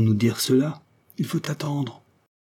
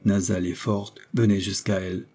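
plain reading voice

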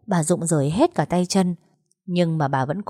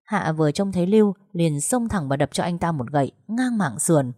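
usual speaking voice

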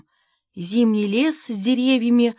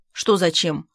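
plain reading voice

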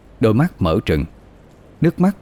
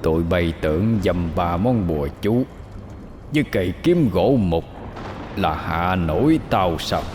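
hoarse demon growl; 6 dB quieter than usual. Acted. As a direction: sneer like a beast hissing. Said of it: "giọng khàn đặc như lửa than bị dội nước". (giọng khàn đặc gầm gừ)

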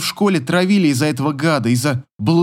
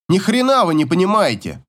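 put-on higher, indignant teenage retort; mild bark; 6 semitones higher than usual. A narrator's reading. Son rejects the social worker, mildly barked retort. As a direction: snap back without really shouting. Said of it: "рявкает сын".

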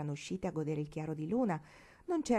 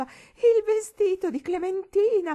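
much higher, louder, slow tearful wail; weeping shout; 13 semitones higher, 11 dB louder, slower than usual. A narrator's reading. Old lady mourning the dress, shrieking tearful wail. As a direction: wail it out in hysterical grief. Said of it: "Piangendo, la vecchia signora gridava".

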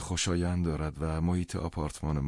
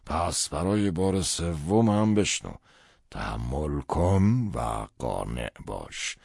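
insistent father; 4 dB louder, much slower than usual. Acted. Father insists on repeating his advice.

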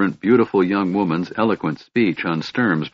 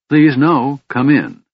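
a higher, louder hurried invitation; quick welcome; 6 semitones higher, 5 dB louder, slower than usual. A narrator's reading. Lorana hurriedly inviting Gunthar into the room. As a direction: rush to welcome him in. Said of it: "Lorana said quickly".

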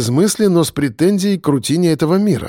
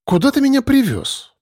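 put-on high, whiny young girlfriend; squeamish; 11 semitones higher than usual. A narrator's reading. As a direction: complain like a spoiled girl. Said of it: "брезгливо поводя плечом".

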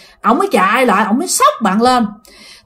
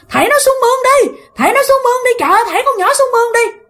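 very high, fast urgent yelling; urgent shout; 12 semitones higher, much faster than usual. Retold or imitated. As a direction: order them to throw her in the ditch. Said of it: "một người la làng lên". (người hàng xóm la lớn, giọng rất cao gấp gáp)

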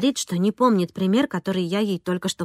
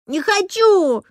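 high-pitched shouted refusal; shrieking little girl digging in; tearful child's shout; 10 semitones higher, 4 dB louder, much slower than usual. A narrator's reading. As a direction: cry out like an upset child. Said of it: "закричала она".

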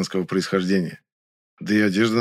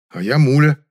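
louder, sarcastic introduction; snide; 5 semitones higher, 7 dB louder than usual. A narrator's reading. Narrator answers Nyura's self-introduction, snidely. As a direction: needle her with mock politeness. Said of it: "ехидно сказал я".

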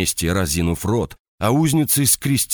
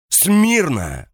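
higher, louder roaring order; full roar; 11 semitones higher, 3 dB louder than usual. Acted. Officer drilling a subordinate.

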